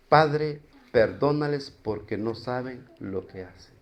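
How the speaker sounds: súplica suave y baja, casi susurrada; oración en voz baja. Acted. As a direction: pide perdón con ternura, sin fuerza. Cita la oración de Jesús. (Jesús en la cruz, rezando suave al Padre)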